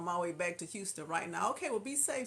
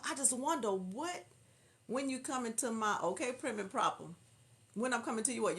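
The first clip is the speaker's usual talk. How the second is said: ordinary talk, nothing marked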